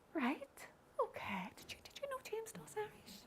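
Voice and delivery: hushed, acted stage whisper; gossipy whisper. Congregant spreading word that James does Irish, whispering. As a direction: whisper the news behind his back.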